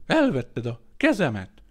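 building to very high, loud wails; keen over the lost hands; wailing lament. A narrator's reading. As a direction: hysterical wailing, slowing at the end. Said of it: "jajveszékelést".

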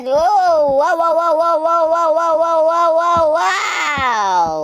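high, loud string of wows; excited wows. Real, not acted. Gamer watching the baby, chanting wow excitedly.

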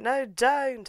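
high-pitched "don't"; mock pleading. Mimics pleading in dream.

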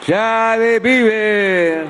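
slow drawn-out rally slogans; ranting dictator speech. Real, not acted. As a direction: call out each slogan to the crowd. Rally closing, shouted slogans. (consignas gritadas lentamente)

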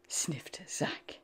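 ordinary delivery, nothing marked

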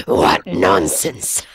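very low, slow, scornful growl; lion mocking the boy's warning; scoffing lion. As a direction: drag out the scorn.

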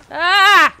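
real high, loud shriek of fright; startled scream. Real, not acted. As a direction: shriek as the sound hits you. Startled by game sound.

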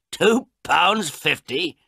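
high, loud and slow disbelief; shocked question. Acted. Old man shocked at the photographer's price, slow and high.

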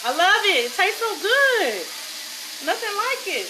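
gushing with real excitement; excited. Real, not acted. Cook raving about ribeye to viewers, excited. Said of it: "Let me stop getting excited".

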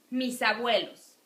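Mis abuelos linked as mi-sa-buelos. Shown as a demonstration. Teacher to students, linking consonant into vowel. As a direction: carry the s into abuelos. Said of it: "it sounds like misa".

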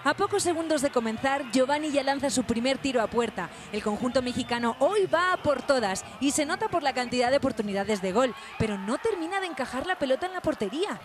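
news voiceover. A narrator's reading. Reporter narrating the match for viewers, news read.

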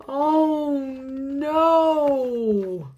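high, loud cry of dismay; alarmed oh no. Acted. Crying out to the children in mock alarm. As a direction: wail it out like it's a disaster. Reacting to rabbit swallowing bee.

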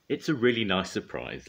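ordinary delivery

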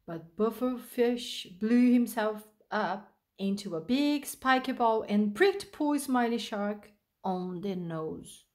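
usual speaking voice

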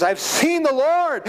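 high, slow, awed announcement; joyful Mary. Acted. Mary telling the disciples the news, high and awestruck. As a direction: let the wonder lift it up high and slow.

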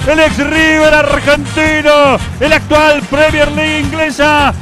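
loud, high, stretched goal commentary; breakneck goal commentary. Acted. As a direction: ride the goal at full force.

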